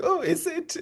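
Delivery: high, falsetto mock interest; fake interest. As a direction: pretend to be intrigued, squeaky high. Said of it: "you have to be like".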